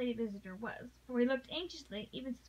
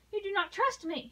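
raised and higher, passionately pleading; a hurt, urgent plea that climbs; 7 semitones higher, 4 dB louder than usual. Acted. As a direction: heroine pleading with Sir Andrew, passionately. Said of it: "she said passionately".

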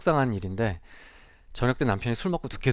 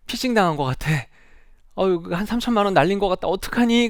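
climbing higher into a wail of panic; a mother frantic over lost money, pitch soaring; mother to daughter after being phished, rising panic; panicking mother; 8 semitones higher, 5 dB louder than usual. Retold or imitated. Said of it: "친정엄마가 얘기를 해요".

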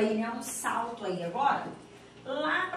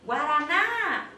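long loud GUARANÁ, peaking on NÁ; drawn-out gate call with no lead-in; calling just the word; 3 semitones higher, 6 dB louder, much slower than usual. Shown as a demonstration. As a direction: call GUARANÁ out slowly, lifting the last syllable. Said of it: "Eu vou chamar só a palavra".

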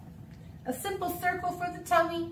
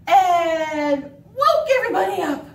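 big, loud storytelling punchline; loud and dramatic finish; loud story climax; 2 semitones higher, 9 dB louder than usual. Acted. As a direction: belt it out to wake everyone. Noisy climax of told story.

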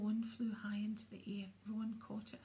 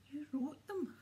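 higher, outraged shout of a question; 6 semitones higher, 4 dB louder than usual. A narrator's reading. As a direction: shout it in shock. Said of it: "Harry shouted".

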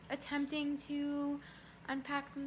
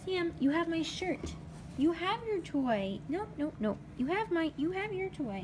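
louder, higher firm puppy scolding; mild scolding; 6 semitones higher, 7 dB louder than usual. Real, not acted. To Sam grabbing her shirt, light scolding. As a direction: tell the puppy off, half playful.

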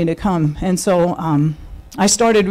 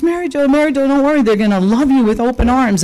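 reassuring friend; 7 semitones higher, faster than usual. Retold or imitated. Friend reassuring nervous narrator.

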